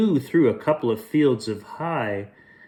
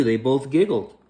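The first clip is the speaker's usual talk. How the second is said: ordinary delivery, nothing marked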